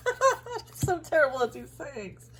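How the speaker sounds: high, laughing self-mockery; laughing at herself. Real, not acted. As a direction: laugh at your own blunder. Laughs at own mistake.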